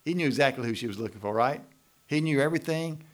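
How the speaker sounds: usual speaking voice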